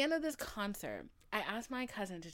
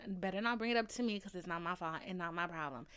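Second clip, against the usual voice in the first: ordinary talk, nothing marked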